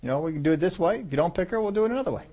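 breezy, unbothered God voice rising to loud, laughing by the end; preacher voicing a carefree God to the congregation; God speaking, offhand. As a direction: play God shrugging it off, chuckling. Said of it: "God said".

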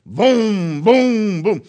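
loud, drawn-out pulling booms; booming sound effect. Retold or imitated. Mule steps into the chain, booming sound effect. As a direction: boom out each heave of the chain.

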